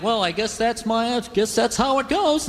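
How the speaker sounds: slow, mild mock resignation; going quietly, resigned. Condemned man accepting his execution, acted quiet resignation. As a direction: give in meekly, drawing the words out. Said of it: "They always go quietly".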